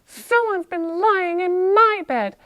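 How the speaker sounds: indignant mid-high bear voice; medium-voiced Mama Bear. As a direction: lift it up and project as mother bear. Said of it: "in her medium voice".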